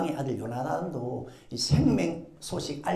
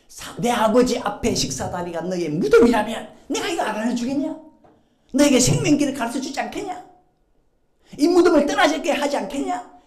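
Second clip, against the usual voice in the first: Jonathan's promise; 5 semitones higher, 7 dB louder than usual. Acted. Jonathan reassuring David.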